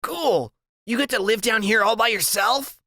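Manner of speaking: put-on high, delighted kid voice; high childlike admiration; high impressed Freak. A narrator's reading. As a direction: sound thrilled by the basement room. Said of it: "And he goes".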